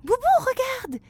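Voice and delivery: put-on high, loud, excited child. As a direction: call out in wonder. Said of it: "s'exclame Flora".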